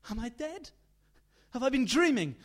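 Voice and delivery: confused fright. Acted. Mother waking confused.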